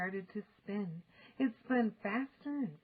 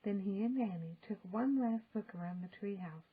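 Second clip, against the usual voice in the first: ordinary delivery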